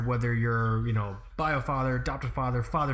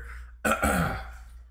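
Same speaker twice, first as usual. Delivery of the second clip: throat clearing. Real, not acted. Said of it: "I'm choking here".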